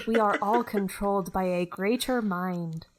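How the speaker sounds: light Scottish lilt; Scottish accent. Acted. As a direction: keep a mild Scottish accent. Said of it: "this is a very Scottish Beth".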